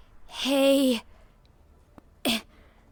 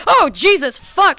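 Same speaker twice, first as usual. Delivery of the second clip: startled; 4 semitones higher, 6 dB louder, slower than usual. Real, not acted. Avatar appears right behind her.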